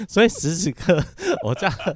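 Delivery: real laughter breaking into speech; amused laughing. Real, not acted. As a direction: boast with a laugh at yourself. Laughing at own cleaning habit.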